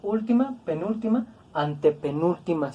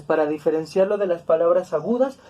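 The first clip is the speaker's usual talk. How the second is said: ordinary delivery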